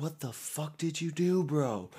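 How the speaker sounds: imitated exasperated "bro" outburst; angry confrontation. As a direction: mimic an outraged friend demanding answers. Friend confronting D over spill.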